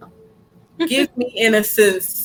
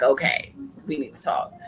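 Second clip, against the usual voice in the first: serious quiet; 5 semitones lower, 6 dB quieter than usual. Retold or imitated. Retelling calm confrontation.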